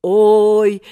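put-on loud, drawn-out old man's oy; quavering old man. A narrator's reading. Seryozha crying out as he ages in seconds, old man's voice. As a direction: cry out like a wheezing old man. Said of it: "захрипел он ещё через несколько секунд дребезжащим стариковским голосом".

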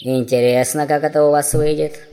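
calm dwarf. A narrator's reading. Dwarf answers the prince's threat, calm. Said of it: "спокойно сказал гном".

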